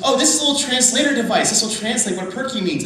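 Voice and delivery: high, loud, quick translator voice; quoted translator. Retold or imitated. Translator explains her little device, imitated in a high voice. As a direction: imitate an eager explainer, pitched well up. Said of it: "She goes".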